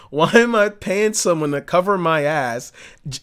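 ordinary talk

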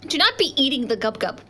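normal talking voice

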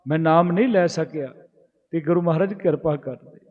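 normal talking voice

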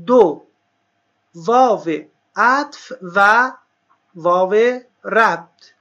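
very drawn-out words, long pauses between items; slow dictation. Real, not acted. Teacher listing cases for students to write down, slow dictation. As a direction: dictate the list slowly for note-takers.

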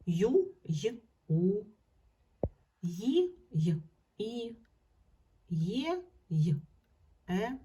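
letter name, then its two sounds, each separate; sounding out letters. Shown as a demonstration. Ї as й, і. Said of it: "позначає дв-, звуки".

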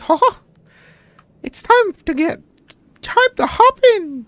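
Mickey Mouse. Pretend. Mickey Vlogs bit.

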